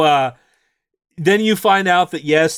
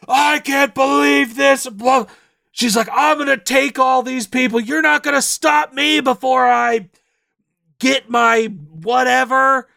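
put-on evil girl villain; 10 semitones higher than usual. Evil painted girl gloating she'll take all the people, high villain rant. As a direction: gloat like a painting turned wicked. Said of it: "she glows, like, red evil".

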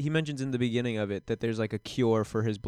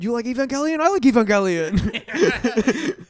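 gushing excitement; 10 semitones higher, 7 dB louder than usual.

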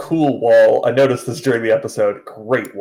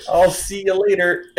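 higher breezy goodbye with a laugh; mock casual; 7 semitones higher than usual. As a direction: toss off a too-cool farewell in a raised voice. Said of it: "is very cool and is like".

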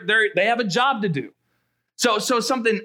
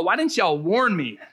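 usual speaking voice

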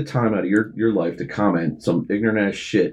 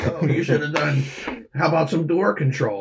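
higher, sneering know-it-all; mocking commenter voice; 6 semitones higher than usual. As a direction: mimic a smug online critic. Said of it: "And you fucking".

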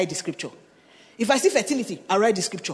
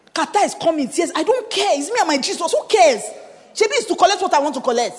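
defiant excitement; 8 semitones higher, 6 dB louder than usual. Retold or imitated. Reliving fierce prayer.

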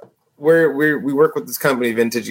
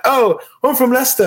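high-pitched imitated English accent; 11 semitones higher than usual. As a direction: play an English customer, pitched up. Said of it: "when English people come".

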